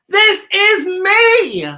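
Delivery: very high, loud, stretched-out scream; a slow, triumphant scream of self; screamed proudly. Shown as a demonstration. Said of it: "Stand up and scream it".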